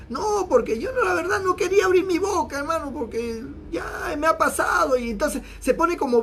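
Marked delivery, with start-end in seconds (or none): 0.1-5.0 much higher, whiny complaint with a tearful edge; mock self-pitying victim. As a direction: play the hurt victim, half-hammed. Gossiper plays the victim.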